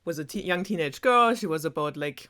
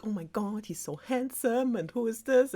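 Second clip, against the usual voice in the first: lightly voiced swooning girl, higher; mildly gushing, pitch lifted; playful imitation of a girl's crush; smitten Rapunzel; 6 semitones higher than usual. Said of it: "she's like".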